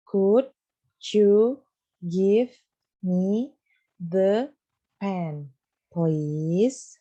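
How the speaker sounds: each word separately, with pauses between. Shown as a demonstration. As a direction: split the sentence into single words for the class to repeat. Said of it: "Ada yang tahu gimana?". (kata demi kata, dengan jeda di antaranya)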